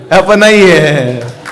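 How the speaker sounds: slow, loud, drawn-out lament; wailing again. Acted. Father learns the money is unpaid, wailing. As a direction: stretch it into a mock cry. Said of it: "फिर रोने लग गया वो".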